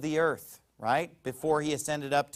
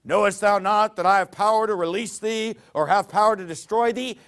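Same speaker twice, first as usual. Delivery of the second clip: mock-grand and lordly, a touch louder; pompous Pilate; 2 semitones higher, 4 dB louder than usual. Preacher mimicking Pilate's boast to Jesus, pompous. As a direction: puff up like a self-important governor. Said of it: "When Pilate got all pompous".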